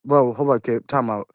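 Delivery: normal talking voice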